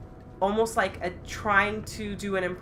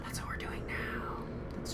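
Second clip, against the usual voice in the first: whisper; 13 dB quieter than usual. Real, not acted. Sudden realization after a gasp.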